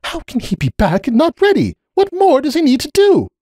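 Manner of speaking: high, strained desperate questions; distressed plea. A narrator's reading. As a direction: beg for an answer, close to panic. Cupcake pleading in distress.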